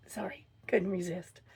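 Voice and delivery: soft whispered apology; whispered aside. Real, not acted. To the viewers after her hoarse pun, a soft sheepish whisper.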